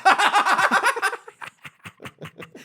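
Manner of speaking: high burst of laughter. Real, not acted. Big laugh after joke.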